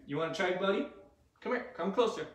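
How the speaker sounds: normal talking voice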